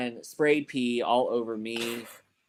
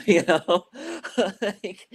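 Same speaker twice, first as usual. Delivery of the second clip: laughing; 7 semitones higher, 5 dB louder, much slower than usual. Real, not acted. Laughing at absurd instructor story.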